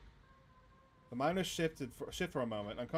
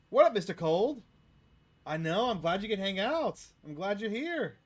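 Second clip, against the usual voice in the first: higher and louder, thrilled; excited greeting; 7 semitones higher, 8 dB louder than usual. Real, not acted. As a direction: gush at the ghost. Greeting the ghost Wayne excitedly.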